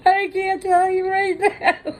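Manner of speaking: choked up, half laughing half crying; tearful laughing sob. Real, not acted. To Robert at the party, crying and laughing at once. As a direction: squeeze it out through tears, slow.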